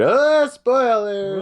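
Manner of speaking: high, loud, stretched-out warning; mock alarm call. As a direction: sing out the warning, slow and loud. Jokingly calls out a spoiler warning.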